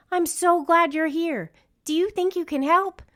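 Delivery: a rescued singer lifting his voice in relief; relieved and eager. Acted. Luke relieved to see pups.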